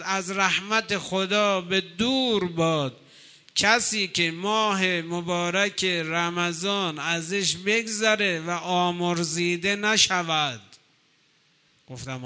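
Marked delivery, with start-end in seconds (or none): 0.0-10.6 slow, loud, grave pronouncement; solemn preaching. Acted. Preacher warning the congregation of Gabriel's curse, slow and loud. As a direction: drive the warning home slowly and loudly.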